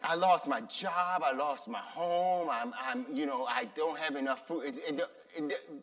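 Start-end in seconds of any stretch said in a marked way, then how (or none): 0.0-4.7 honest complaint. Shown as a demonstration. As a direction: sigh it out, lightly worn down. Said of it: "tell them".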